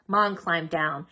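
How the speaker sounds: usual speaking voice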